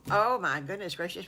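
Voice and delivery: ordinary delivery, nothing marked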